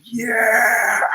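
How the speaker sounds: high, drawn-out triumphant yell; retold excited yell. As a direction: re-enact screaming with joy, one long high word. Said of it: "I yell".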